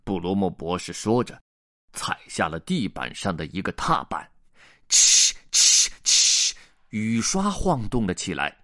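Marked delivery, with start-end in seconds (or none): 4.9-6.5 short sharp voiceless "chh" bursts; three loud hisses; hissing noise. Acted. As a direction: imitate a hissing mechanism. Narrator imitates pedal hiss, wipers.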